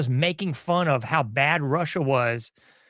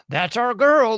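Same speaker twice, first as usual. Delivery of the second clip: mock proud supporter; 5 semitones higher, 6 dB louder, slower than usual. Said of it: "listening to this and going".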